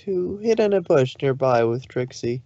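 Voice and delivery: plain reading voice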